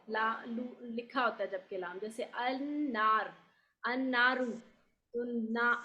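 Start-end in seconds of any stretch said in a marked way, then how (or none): 2.3-4.5 النار said as annaar, the lam dropped and the noon doubled; slow النار gliding straight into the noon; silent lam demo. Shown as a demonstration. Teacher demonstrates النار to the class, the lam dropped. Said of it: "لام نہیں پڑھتے".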